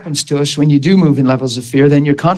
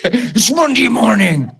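slow, higher mock-grumpy voice, laughing; grumpy Monday mock; 8 semitones higher, slower than usual. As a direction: drag it out like a sulky Monday. Mocking a grumpy Monday attitude.

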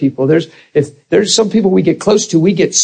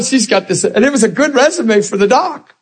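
ordinary talk

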